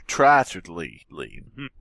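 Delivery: ordinary talk, nothing marked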